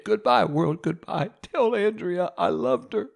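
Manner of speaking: mock farewell. Melodramatic farewell adrift at sea.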